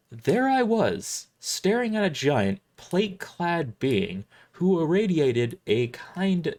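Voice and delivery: ordinary delivery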